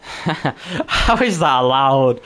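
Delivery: amused, slow, swooping exclamation; laughing disbelief. Real, not acted. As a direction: break into laughter, then stretch out the question. Amused shock at a username.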